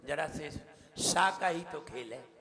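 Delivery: normal talking voice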